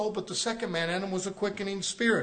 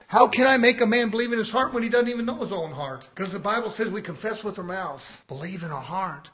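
ordinary delivery

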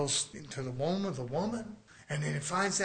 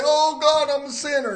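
mock scared prayer; 9 semitones higher, 8 dB louder than usual. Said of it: "And they're scared".

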